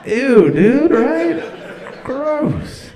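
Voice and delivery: put-on high, loud, laughing valley-girl gossip; squealing disgust, played for laughs; mock gossipy nurse. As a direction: ham up a grossed-out texter. Mocking nurse texting doctor buddies.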